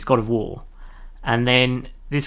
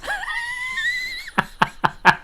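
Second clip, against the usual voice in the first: loud high laugh. Real, not acted. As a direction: burst of shrieking laughter. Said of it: "without laughing".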